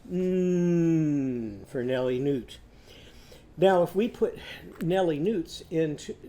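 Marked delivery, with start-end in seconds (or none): 0.1-1.7 long, sustained hum; humming "mm". Shown as a demonstration. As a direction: hold out an "mm" sound. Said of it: "and go".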